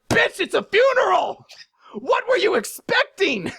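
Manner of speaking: high, slow mock-furious shout; outraged retort. Acted outraged retort to Urza.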